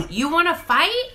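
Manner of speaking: skit fighter. Acted. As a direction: act out a hammed-up fight challenge. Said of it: "I'm doing a skit".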